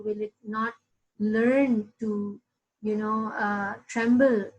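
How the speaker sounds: ordinary talk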